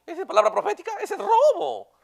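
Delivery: a high, indignant outburst; outraged disbelief. Real, not acted. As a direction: expose the fraud in outrage. Indignant retort to mock prophecy.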